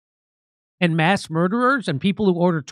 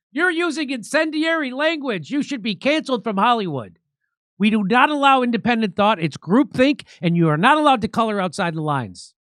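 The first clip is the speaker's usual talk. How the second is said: high, sweeping mock outrage; mock scolding; 4 semitones higher than usual. Host parodies Hollywood censors scolding an actress, mock outrage. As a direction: hand down the rules as a hammed-up censor.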